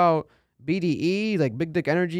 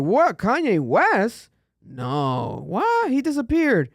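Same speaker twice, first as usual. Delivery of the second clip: high-pitched, drawn-out mock shock; acted disbelief; 9 semitones higher, much slower than usual. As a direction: replay your stunned reaction in a soaring voice. Acts out disbelief at surprise appearance.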